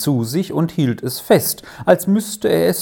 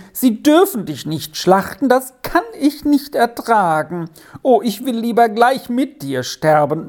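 high, loud, desperate lament; loud wailing; 9 semitones higher, 3 dB louder than usual. Acted. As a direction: wail over the doomed kid goat. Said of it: "jammerte er laut". (laut jammernd, höher und lauter)

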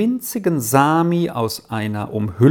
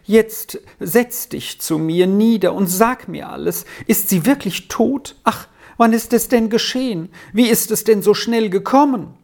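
anxious mother, pitched higher; distressed questioning, growing more urgent; trembling voice; 7 semitones higher than usual. A narrator's reading. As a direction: plead for answers, rising into higher-pitched distress. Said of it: "fuhr mit zitternder Stimme fort".

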